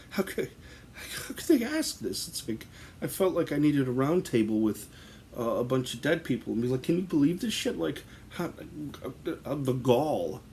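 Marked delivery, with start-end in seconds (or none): 0.1-2.3 mock outrage. Retold outraged inner thought. 6.8-10.4 mock indignation. Imagined round table of dead.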